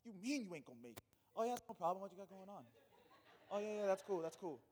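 mock easygoing pastor.

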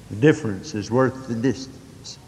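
low, soft, after a long pause; hushed reflection. Retold or imitated. Preacher recalling his words at an unforgettable sight, hushed and low. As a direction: let it drop low and quiet, as if musing. Retold awed reflection at mountain view.

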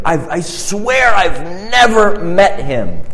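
drawn-out, high-pitched sworn denial; vehement denial. Acted. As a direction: swear it emphatically, stretching the words.

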